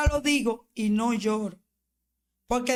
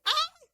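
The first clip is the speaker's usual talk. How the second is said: retold cry. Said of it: "Y yo dije".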